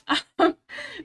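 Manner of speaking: high, loud burst of laughter; short, high-pitched real laugh. Real, not acted. Laughs at own list of troubles.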